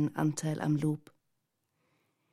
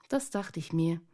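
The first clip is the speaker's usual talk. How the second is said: ordinary delivery, nothing marked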